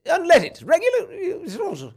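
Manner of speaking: high, loud hammed-up English accent; mock English accent. Host imitating an English gas attendant, hammed-up English accent. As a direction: play a prim English pump attendant with a put-on accent. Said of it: "You ever had an Englishman walk up and be like".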